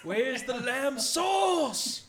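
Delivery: high, drawn-out yell on a very slow count; mock angry yell. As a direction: yell it like an outraged chef, stretching the last word. Shouted Gordon Ramsay catchphrase bit.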